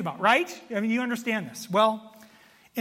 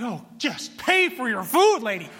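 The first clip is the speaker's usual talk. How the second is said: exasperated mutter; 3 semitones higher, 6 dB louder than usual. Retold or imitated. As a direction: roll your eyes at her and say it. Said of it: "It's like".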